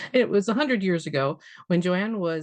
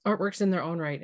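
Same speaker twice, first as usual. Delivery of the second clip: ordinary talk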